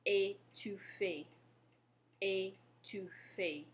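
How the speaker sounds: "étouffé" slowly, syllable by syllable; careful French pronunciation. Shown as a demonstration. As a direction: model "étouffé" for learners, ay-too-fay, drawn out. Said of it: "The first word we have is".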